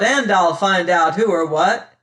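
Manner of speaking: fierce loud declaration. A narrator's reading. Martin answering the unseen whisperers, fierce and loud. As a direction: call it out boldly to the hidden whisperers. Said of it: "fierce and loud".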